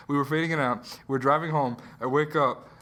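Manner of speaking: normal talking voice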